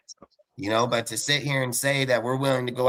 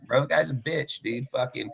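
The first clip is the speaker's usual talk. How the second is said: normal talking voice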